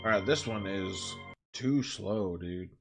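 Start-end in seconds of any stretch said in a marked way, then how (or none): none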